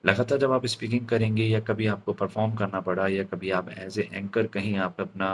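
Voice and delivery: ordinary talk, nothing marked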